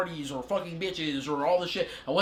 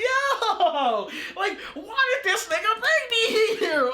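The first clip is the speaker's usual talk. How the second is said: much higher, laughing and slowed, baffled; laughing disbelief; 14 semitones higher, 4 dB louder, much slower than usual. Retold or imitated. To himself, wondering why he was brought here, laughing disbelief. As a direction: laugh through it like you can't believe where you are. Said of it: "I'm like".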